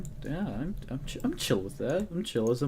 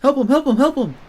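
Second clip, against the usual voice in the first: frantic high shout for help; excited shouting; 13 semitones higher, 12 dB louder than usual. Real, not acted. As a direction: yell it out in a panic. Character drowning in game.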